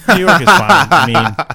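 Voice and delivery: a big exasperated yell about the city; exasperated outburst. Real, not acted. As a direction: blurt it out at full volume in exasperation. Exasperated outburst about New York.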